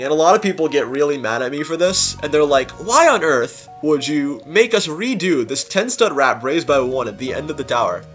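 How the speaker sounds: normal talking voice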